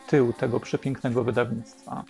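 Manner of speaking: ordinary delivery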